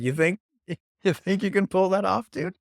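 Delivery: mild mock scolding of a slacker podcaster; mock skeptical listener. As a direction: tease like a doubtful listener with a real job. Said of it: "Someone who has a real job is listening to this and is like".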